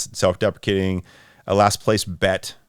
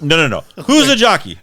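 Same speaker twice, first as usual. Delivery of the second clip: high, loud exasperated question; confused Abbott and Costello; 7 semitones higher, 4 dB louder than usual. Acted. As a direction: push back, baffled and frustrated. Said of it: "Abbott and Costello thing".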